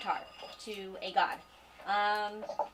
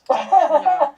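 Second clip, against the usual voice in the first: loud protest; 9 semitones higher, 17 dB louder than usual. Real, not acted. Protests being zoomed on camera.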